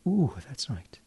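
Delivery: soft whispered ooh and affirmation. Acted. Hypnotist soothing the listener, whispered. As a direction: sigh it out in a whisper.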